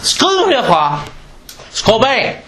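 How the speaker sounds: high, annoyed dismissal; hammed-up impatient dad; mock fed-up father. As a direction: shoo the child away in irritation. Fed-up father shooing child.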